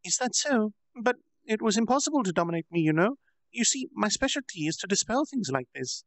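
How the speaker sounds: put-on high girlish voice, boasting; proud proclamation. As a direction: puff up and proclaim it. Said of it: "Milim proudly proclaimed".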